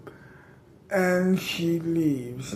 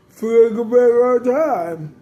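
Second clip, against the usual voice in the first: villain laugh; 4 semitones higher, 6 dB louder than usual. Acted.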